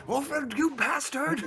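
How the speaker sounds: high laughing outburst; shout. Real, not acted. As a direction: yell it out, half laughing. Player curses at other player.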